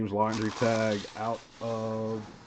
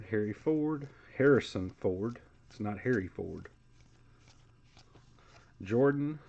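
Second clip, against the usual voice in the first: ordinary talk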